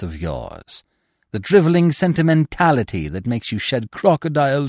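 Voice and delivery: cold, gloating widow taunting her captive; vengeful widow. A narrator's reading. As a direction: spit it out like a triumphant enemy. Widow confronts bound Lupin.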